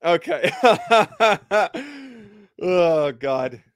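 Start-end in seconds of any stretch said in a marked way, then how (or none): 0.6-2.1 high, helpless burst of laughing; real laughter. Real, not acted. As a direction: crack up at the joke. Laughing at co-host's crude joke.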